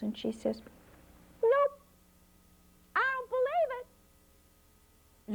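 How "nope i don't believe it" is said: high, slow disbelieving drawl; doubting little girl, high and slow; skeptical little girl. A narrator's reading. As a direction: stretch it out high, not fooled one bit. Flossie doubts fox.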